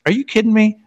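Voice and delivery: high, startled disbelief; mock stunned reply; shocked disbelief. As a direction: gasp it out in shock. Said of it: "And he's like".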